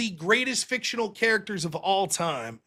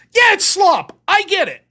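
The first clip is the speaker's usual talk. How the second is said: loud concession; 10 semitones higher, 8 dB louder than usual. Real, not acted. Conceding to critics.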